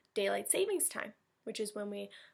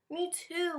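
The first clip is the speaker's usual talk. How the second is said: ordinary delivery, nothing marked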